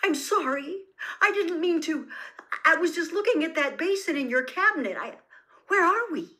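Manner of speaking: frantic, panicked. A narrator's reading. Harry pleading with the frozen Dumbledore, high and panicked. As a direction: blurt it out in alarm, fast. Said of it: "a kind of strangled whisper".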